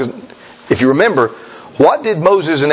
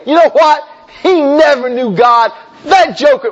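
judgmental gossip; 6 semitones higher than usual. Retold or imitated. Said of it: "now you're judging".

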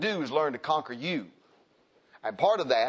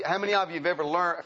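normal talking voice

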